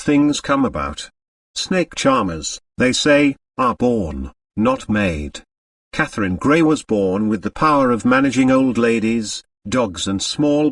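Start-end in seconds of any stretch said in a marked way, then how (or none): none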